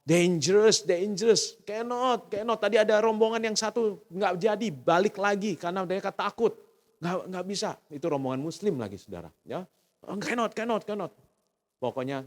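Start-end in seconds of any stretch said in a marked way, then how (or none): 0.0-2.6 slow, drawn-out high warning voice; higher, slowed fretful warning; worried restaurant owner. Retold or imitated. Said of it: "yang punya restoran aja bilang". 10.0-11.1 higher, slowed fretful warning; worried restaurant owner. Retold or imitated. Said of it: "yang punya restoran aja bilang".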